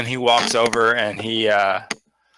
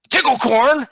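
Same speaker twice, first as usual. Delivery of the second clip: high, loud squeaky greeting yelled; kobold password shout; 13 semitones higher, 6 dB louder than usual. Acted. Kobold at the door shouting the password at Meepo, high and loud. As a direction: blurt the password like an eager kobold.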